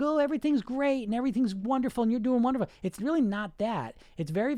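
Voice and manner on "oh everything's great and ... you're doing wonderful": higher, gushing false positivity; mock cheerleading voice. Showing what the coaching voice is not, mock cheerful. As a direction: gush in a mock, sugary way. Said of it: "It's not like some".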